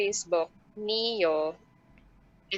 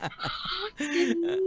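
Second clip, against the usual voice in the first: retold laughing reply; 10 semitones higher, much slower than usual. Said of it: "parang sabi niya".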